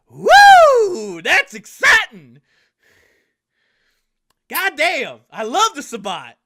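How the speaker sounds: very high, loud hollering cheer; a hillbilly whooping with glee. Acted. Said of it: "looks at you like he's about to be all teary-eyed".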